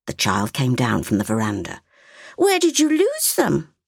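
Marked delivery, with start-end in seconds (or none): 2.4-3.7 put-on a curious little girl asking; little girl's voice. A narrator's reading. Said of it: "she asked".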